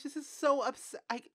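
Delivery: normal talking voice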